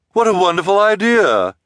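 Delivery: high, loud delighted gush; exclaiming. A narrator's reading. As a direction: gush as if it's brand new. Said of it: "Esme exclaimed".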